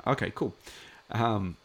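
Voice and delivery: ordinary delivery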